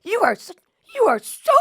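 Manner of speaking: retelling her angry comeback; mock heated retort. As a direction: build the comeback hotly. Her own retort, escalating.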